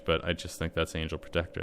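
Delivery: ordinary delivery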